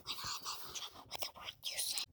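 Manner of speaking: whisper. Real, not acted. A child whispering to her sister mid-story, whispered. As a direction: whisper it so the recording won't catch it.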